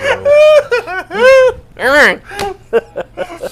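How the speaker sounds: high-pitched, drawn-out helpless laughter; long laughing fit. Real, not acted.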